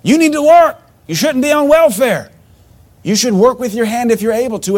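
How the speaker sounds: high, loud preaching rebuke; retold fiery demand to get a job; shouted command. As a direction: yell it at them from the pulpit. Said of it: "but I said".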